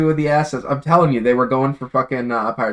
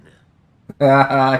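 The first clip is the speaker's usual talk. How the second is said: hearty laugh; 2 semitones higher, 5 dB louder than usual. Real, not acted. Laughs at the pirate voice.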